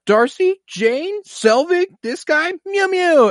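mock high-pitched roll call of names. Friends pop up on Darcy kissing the intern, high mock calls. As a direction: call out each name high and rising. Acted quote of characters popping up.

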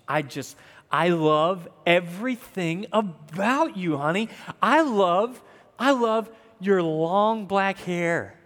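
hammed-up lovesick husband, slow and drawn out; hopeless romantic. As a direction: play the gushing romantic, savoring every word. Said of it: "pretend for a minute that I'm just Mister Hopeless Romantic".

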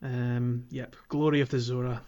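ordinary talk

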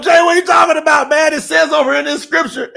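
high, fast shouted protest from a fired-up critic; mock angry objector. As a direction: yell it like an offended churchgoer arguing scripture. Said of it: "They're gonna go".

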